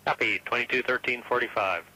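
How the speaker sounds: ground control readback; over the radio link; radio transmission. Real, not acted.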